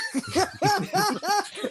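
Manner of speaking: a loud, high burst of laughter. Real, not acted. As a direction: crack up at the memory. Laughing at mom's remark.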